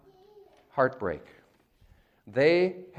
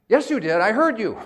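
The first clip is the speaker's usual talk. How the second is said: loud, raised-pitch insistence; scolding dad; 8 semitones higher, 7 dB louder than usual. Acted. Dad rebutting his kid's denial, scolding. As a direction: shoot down the denial.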